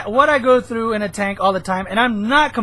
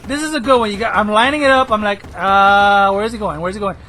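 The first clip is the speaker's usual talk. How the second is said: ordinary talk, nothing marked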